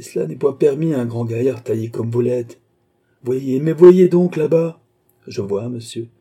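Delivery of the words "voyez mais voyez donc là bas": loud, high and drawn out; urgent exclamation. Acted. Father urging his son to look, exasperated and loud. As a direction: press it on him, voice raised, slowly.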